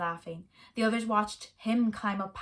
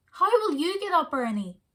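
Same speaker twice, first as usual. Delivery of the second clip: louder, higher call to someone below; 7 semitones higher, 5 dB louder than usual. Acted. Gloria calling down to Ernie below the wall, louder and higher. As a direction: shout down from the top of the wall. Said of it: "Gloria called down".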